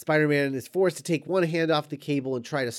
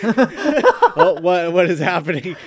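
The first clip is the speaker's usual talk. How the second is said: bewildered Spider-Man; 6 semitones higher, 11 dB louder than usual. Retold or imitated. Spider-Man baffled as Doc Ock grabs Goblin, loud and high. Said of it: "And he's like".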